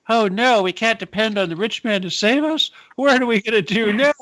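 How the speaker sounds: high-pitched comic dismay; hammed-up alarm, mocking the worried; mock panic. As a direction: wail in pretend helplessness. Mimics panicked believers, then laughs.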